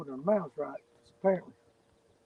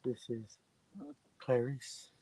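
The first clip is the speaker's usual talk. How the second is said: put-on failed British accent; 4 semitones lower, 10 dB quieter, much slower than usual. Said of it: "I can't do a British accent".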